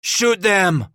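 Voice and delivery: high, loud angry command; furious roar. A narrator's reading. Henri orders his men to fire, roaring in fury. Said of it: "Henri roared in fury".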